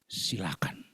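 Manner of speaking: soft unvoiced whisper; a quiet whispered go-ahead. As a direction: breathe it under your breath. Whispered aside in story.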